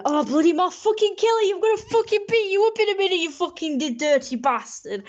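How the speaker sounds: high-pitched rant, falling away at the end; hammed-up husband's threat; abusive husband. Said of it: "That's what he says to his wife, 'cause he's abusive".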